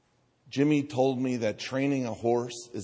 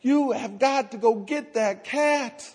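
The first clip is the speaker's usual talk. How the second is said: put-on much higher child voice, pushing hard; insistent kids; 14 semitones higher, 4 dB louder than usual. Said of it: "They go".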